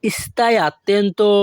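"stai attento" said very slowly as one phrase; slow joined phrase. Shown as a demonstration. Teacher joining the two Italian words for learners, very slowly. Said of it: "আর দুটি যদি আমরা একসাথে বলি".

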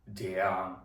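usual speaking voice

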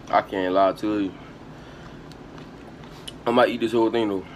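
ordinary talk

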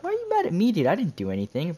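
squeaky, high-pitched indignant defence; high, exaggerated protest; mock protest. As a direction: plead innocence in a shrill-high retelling voice. Retold protest to angry texter.

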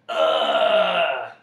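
long, loud groan of dismay; loud drawn-out "ugh" gasp. A narrator's reading. The bug realizing he is hungrier, loud gasp. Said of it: "gasped the bug".

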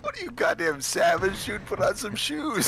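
hammed-up horrified snob; mock outrage. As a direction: play the shocked snob.

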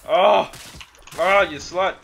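loud, high yelps mid-fight; startled shout. Real, not acted. Gamer shouting at an enemy player, loud yelps. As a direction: shout it out, caught off guard.

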